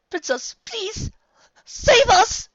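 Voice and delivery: very high, slow begging cry; terrified prayer; desperate plea. A narrator's reading. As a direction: beg for rescue.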